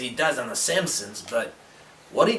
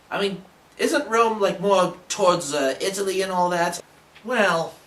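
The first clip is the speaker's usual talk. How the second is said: ordinary delivery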